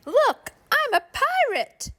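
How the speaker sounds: put-on high, excited child's voice; a kid showing off a pirate costume; child playing pirate.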